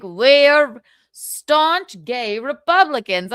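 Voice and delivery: hammed-up solemn pride; mock proud reply. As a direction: announce it like a proud declaration. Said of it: "they're like".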